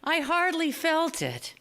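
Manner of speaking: lightly voiced injured father, breezy; dad's brave reply. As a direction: play down the injury cheerfully. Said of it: "he told Mister Denver".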